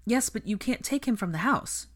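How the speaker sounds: high-pitched, mild scold. A narrator's reading. Ginny stops Fred taking the boy out, scolding. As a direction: object firmly, telling him off. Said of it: "Ginny scolded".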